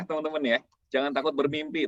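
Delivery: usual speaking voice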